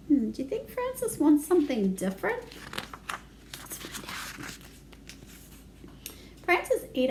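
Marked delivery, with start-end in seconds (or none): none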